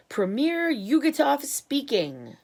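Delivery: a lightly voiced Russian premier answering; voice from Moscow. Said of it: "said the voice from Moscow".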